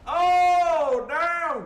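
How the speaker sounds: real startled exclamation; shocked shout. Real, not acted. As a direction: yell it like something just blew up. Sudden shock in match.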